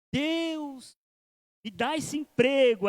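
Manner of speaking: high, then loud and slow plea to God; pleading prayer. Acted. Unqualified job seeker begging God, pleading loudly. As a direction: beg God for the job, dragging the words.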